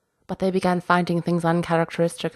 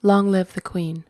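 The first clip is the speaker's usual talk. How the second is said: usual speaking voice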